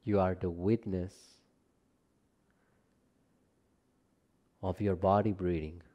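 calm, measured relaxation guidance, phrase by phrase; guided meditation. Acted. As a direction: guide them gently down into rest, unhurried and spacious. Leads a yoga nidra practice.

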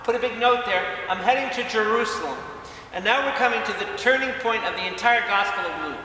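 loud, high, impassioned sermon delivery; booming pulpit voice; fiery preaching. Acted. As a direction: preach it out with full force. Preaching at full force opening.

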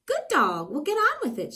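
high, loud impatient witch; witch crying out. Acted. Zildy urging the dog on, high and loud. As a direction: hurry him along, sharp and high. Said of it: "she cried".

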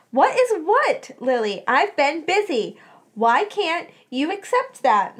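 high, drawn-out exasperated retort; defensive irritation. A narrator's reading. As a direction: fend off the questioning, slowly and pointedly. Friends quarrel, she dodges questions.

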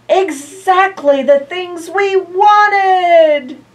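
high, loud, slow cry of joy; thrilled animal voices; delighted animals. Acted. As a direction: gush over the presents.

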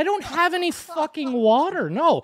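high, incredulous re-enacted outburst; retold incredulous outburst. Refusing the driver's request for water, incredulous and high. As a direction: squeak it out in disbelief.

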